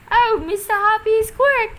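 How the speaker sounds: high, loud thrilled cry; excited cry. A narrator's reading. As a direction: cry out in delight. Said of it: "she was crying".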